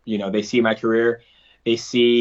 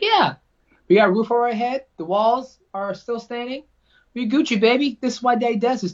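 much higher, sing-song voice playing it up for his kid; reassuring dad; 12 semitones higher than usual. Dad telling son after the lost fight they're okay, much higher voice. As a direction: pitch way up like talking to a little child.

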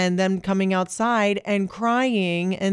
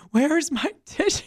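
high, hammed-up tearful quote with a laugh; playfully blubbering; mock weepy wail; 9 semitones higher than usual. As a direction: imitate yourself crying out for tissues. Acts out needing tissues while crying.

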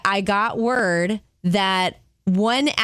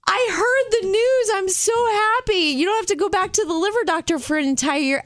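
much higher, gushing with joy; telling her sister the liver news, overjoyed; 11 semitones higher than usual. Retold or imitated. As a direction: burst out the good news. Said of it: "And I was like".

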